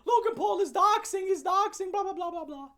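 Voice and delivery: mimicking angry commenters, high and loud; mocking commenters. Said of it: "So people are like".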